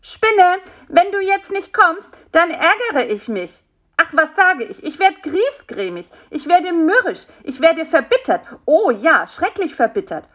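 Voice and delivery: high, petulant little fly ranting; small, indignant insect scolding; nagging clamour. A narrator's reading. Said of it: "ging das Gezeter von vorne los".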